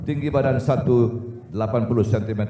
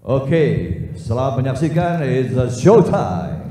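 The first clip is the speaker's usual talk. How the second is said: hype announcer; 5 semitones higher, 5 dB louder than usual. Acted. Announcer's closing hype line.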